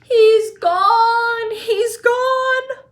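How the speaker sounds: high loud wailing scream; a mother losing her son, screaming; anguished scream. A narrator's reading. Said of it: "she screamed".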